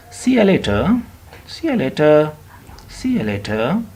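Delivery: SEE YOU LATER linked as "see-ya later"; frank, informal farewell. Shown as a demonstration. Teacher drilling learners at ease with English, informal linked words. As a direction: repeat it with you shrunk to "ya". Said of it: "If you are pretty frank with English".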